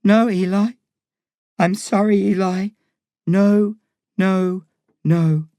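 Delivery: tearful refusal. A narrator's reading. Crying mum refusing her son, tearfully. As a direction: apologise through tears, repeating the refusal. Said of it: "She's crying now too".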